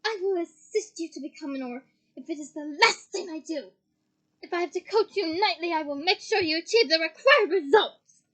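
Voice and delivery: resolute vow, building to very loud; ringing tones. A narrator's reading. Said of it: "she said in ringing tones".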